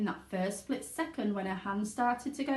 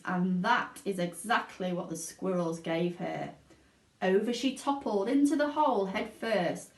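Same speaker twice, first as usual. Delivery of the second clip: usual speaking voice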